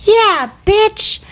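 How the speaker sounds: high-pitched little-kid imitation; tiny toddler voice. Toddler son backing up mom from the back seat, tiny high voice. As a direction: squeak it out like a toddler cheering mom on. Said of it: "his little tiny, you know, barely one and a half year old voice".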